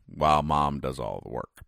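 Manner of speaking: ordinary delivery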